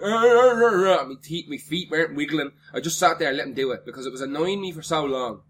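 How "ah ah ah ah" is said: loud high panicky yelps; panicked yelps. Retold or imitated. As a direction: yelp in mock panic. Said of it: "I wasn't fucking".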